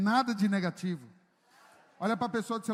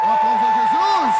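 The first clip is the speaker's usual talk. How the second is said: much louder shout to the crowd; loud call for applause; 2 semitones higher, 17 dB louder than usual. Real, not acted. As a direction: call the whole church to clap. Calling for applause in church. (chamando aplauso bem alto)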